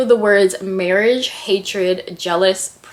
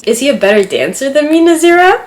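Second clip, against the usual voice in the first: excited; 7 semitones higher, 8 dB louder than usual. Real, not acted. Reads romantic line, swooning.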